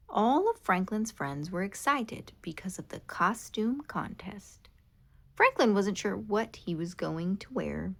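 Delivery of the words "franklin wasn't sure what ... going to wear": plain reading voice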